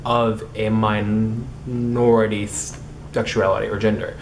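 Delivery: ordinary delivery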